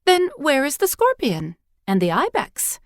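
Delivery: put-on high, eager child asking; a curious little girl, pitched high; young girl's voice. A narrator's reading. Girl questioning the old priestess, high and eager.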